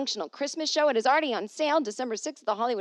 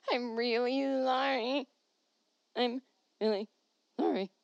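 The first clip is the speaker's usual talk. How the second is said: comic pleading, higher and stretched; silly whiny apology; 4 semitones higher than usual. Pretend. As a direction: drag out a babyish sorry.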